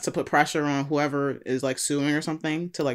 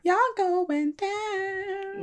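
sing-song taunt; 5 dB quieter, much slower than usual. Pretend. Teasing tech workers, drawn out.

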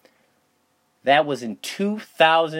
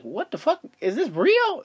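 shocked disbelief; 11 semitones higher than usual. Retold or imitated. Retold shock at stranger.

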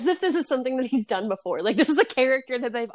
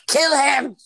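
loud, higher shout; shouted command; 5 semitones higher, 7 dB louder than usual. Retold or imitated. TV voice urging Henry to kill, loud shout. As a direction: yell the order. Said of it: "they're like".

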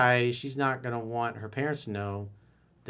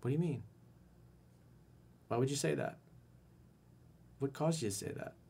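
soft probing question; 6 dB quieter than usual. Shown as a demonstration. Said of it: "I would say".

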